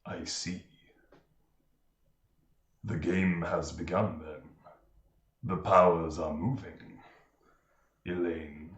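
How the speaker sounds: low, menacing priestess voice; sea priestess voice. Acted. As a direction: speak low and cold, like an ancient sea witch.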